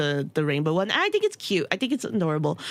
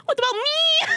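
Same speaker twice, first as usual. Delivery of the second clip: high mock-wail through laughter; laughing wail; 4 dB louder than usual. Real, not acted. Complaining about missing flags, laughing high wail.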